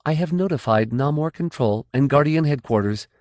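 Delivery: crisp command voice; Kafira Kay. Acted.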